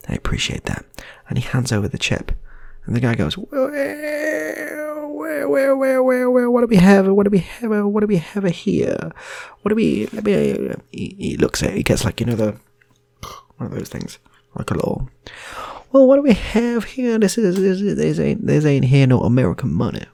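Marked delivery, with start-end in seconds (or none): 3.4-10.8 very high, loud Southern voice; American accent, squeaky. Retold or imitated. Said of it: "I can only do two American accents". 15.9-20.0 very high, loud Southern voice; American accent, squeaky. Retold or imitated. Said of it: "I can only do two American accents".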